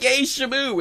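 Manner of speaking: high-pitched mock childlike cheer; excited kid. Co-host retelling childhood delight, excited cheer. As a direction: imitate a kid's delighted yay. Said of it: "When I was a kid".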